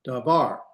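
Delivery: "Davar" with the weight on the last syllable, var; stress on VAR. Shown as a demonstration. Teacher modelling Hebrew end accent, "Davar" stressed on var. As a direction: say "Davar" leaning on the final var. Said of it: "put the accent over here in the var".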